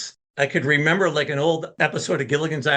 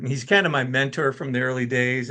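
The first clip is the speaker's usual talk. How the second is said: ordinary talk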